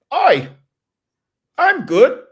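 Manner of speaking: put-on high, loud overdone Aussie accent; terrible Aussie accent. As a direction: ham up a bad Australian accent. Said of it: "That is my terrible, uh, Aussie, uh, accent".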